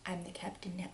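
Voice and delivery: breathy hush, menacing; whispered line. Retold or imitated. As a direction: whisper it like a movie villain. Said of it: "I said".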